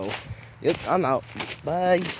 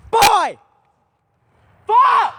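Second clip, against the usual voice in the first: shouting; 13 semitones higher, 5 dB louder than usual. Real, not acted. Yelling into woods for echo.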